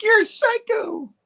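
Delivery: high, drawn-out mock cry; daughter's outcry. Daughter to the man lunging at her, high drawn-out outcry. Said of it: "She's like".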